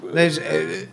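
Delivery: normal talking voice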